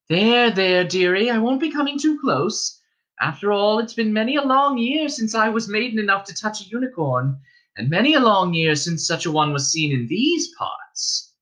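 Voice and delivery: calm the skittish unicorn; soothing innkeeper's wife. Acted.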